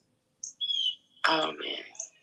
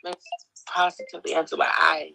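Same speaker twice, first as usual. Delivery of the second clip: ordinary delivery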